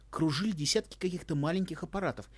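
usual speaking voice

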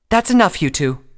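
high, loud command; Twilight's angry shout. A narrator's reading. Twilight stopping two fighting friends, angry shout. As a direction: break up a fight with a sharp shout. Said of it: "Twilight asked angrily".

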